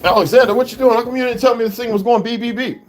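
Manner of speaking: loud, high call across the house, a bit fast. Real, not acted. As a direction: call him out for ignoring the beeping. Calling out to someone in the house.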